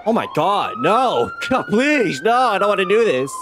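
panicked pleading. Real, not acted. Player forced into a dark choice, panicked pleading. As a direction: beg the game not to make him do it.

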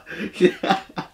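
soft unvoiced laugh; breathy laugh. Real, not acted. Reacting to the track.

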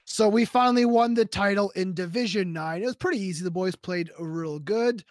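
calm host outro voice; outro sign-off. Acted. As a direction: wrap up the video for viewers.

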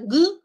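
G loud and high as a rasping velar fricative. Shown as a demonstration. Teacher modelling the fricative velar sound. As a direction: blast out a breathy G with no closure. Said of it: "pero en versión fricativa".

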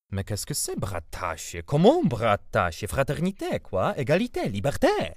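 drawn-out, rising exasperated French protest; defensive bewilderment. A narrator's reading. Uncle shielding himself from the boy with French, defensively bewildered. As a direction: take refuge in emphatic French. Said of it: "lecz nie agresywnie, a przeciwnie, jak ktoś, kto się chroni".

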